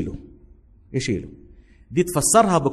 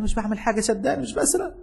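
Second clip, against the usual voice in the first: put-on higher, pleading child's voice; frightened boy pleading; 8 semitones higher, 4 dB quieter than usual. Street boy pleads with the soldier, high and pleading. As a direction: beg him to believe you're no thief. Scared boy answers the stranger.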